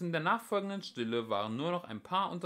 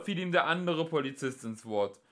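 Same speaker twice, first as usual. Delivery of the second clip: ordinary delivery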